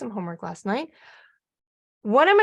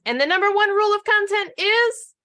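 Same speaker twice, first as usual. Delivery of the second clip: building suspense; 8 semitones higher, 7 dB louder than usual. Real, not acted. Teaser question to the audience.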